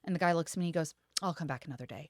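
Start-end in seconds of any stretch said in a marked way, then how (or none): 1.2-2.1 low, soft mumbled brush-off; subdued retold excuse; imitated retreat. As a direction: imitate a customer quietly backing out. Customer backing out of purchase.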